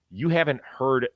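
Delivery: ordinary delivery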